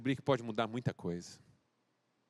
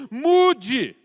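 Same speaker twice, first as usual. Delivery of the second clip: one loud, high preached word; shouted command; 10 semitones higher, 15 dB louder than usual. Acted. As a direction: hammer the final word home. Closing shout of the sermon call.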